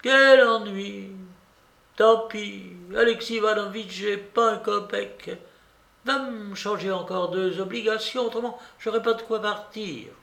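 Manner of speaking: put-on high-pitched old-lady voice; vexed babouchka. A narrator's reading. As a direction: give instructions in a high, put-out voice. (vieille dame contrariée)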